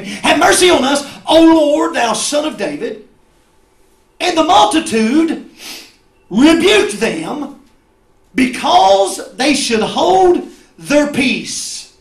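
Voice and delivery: high, loud proclamation of the verse; preached scripture reading. Acted. Preacher reads of two blind men, loudly proclaiming. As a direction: call the verse out to the back pew.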